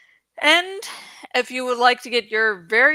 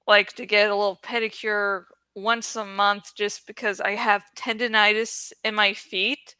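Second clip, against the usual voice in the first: normal talking voice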